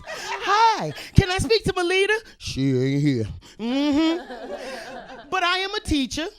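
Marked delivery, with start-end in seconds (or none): 0.0-2.1 bill collector voice. Retold or imitated. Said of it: "That's the bill collector's voice". 2.4-3.3 low gruff denial; deep disguised voice. Pretend. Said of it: "you know that voice".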